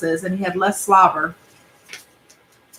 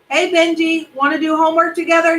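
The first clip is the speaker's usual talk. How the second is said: put-on higher, quicker friendly boy; eager kid neighbor; 9 semitones higher than usual. Alexander riding up to Benji on his bike, higher and eager. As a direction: chirp it like a keen classmate.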